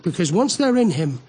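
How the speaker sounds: usual speaking voice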